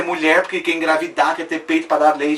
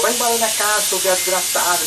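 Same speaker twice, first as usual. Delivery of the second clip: high, harsh retold yell of a furious father; father's angry yell; 4 semitones higher than usual. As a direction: throw her out, voiced higher. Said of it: "ele falou". (pai furioso gritando, imitado)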